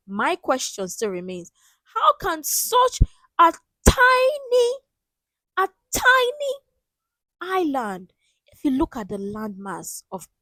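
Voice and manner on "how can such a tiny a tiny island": incredulous. Real, not acted. Host marvelling at Britain's size, high incredulous squeak. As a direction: squeak out tiny in disbelief.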